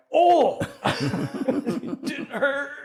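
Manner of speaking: high, slow, laughing fake toughness; cocky through laughter; laughing mock bravado. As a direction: pretend it didn't hurt, laughing. Mock tough after being punched.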